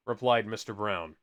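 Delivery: ordinary delivery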